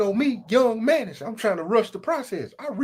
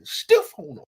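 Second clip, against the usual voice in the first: high whispered boast; 11 semitones higher, 3 dB louder than usual. Acted. High breathy punchline.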